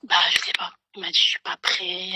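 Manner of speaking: whispering; hushed whisper; chuchotement. Real, not acted. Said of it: "en chuchotant". (voix chuchotée)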